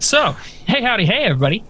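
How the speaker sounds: lightly voiced radio host greeting; a hint of a cheery radio host. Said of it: "you should go into radio".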